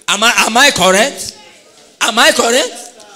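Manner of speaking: loud, high rising "Am I correct?" shouted to the crowd; loud call-out. Acted. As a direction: throw the question out to the hall. Shouting question at audience.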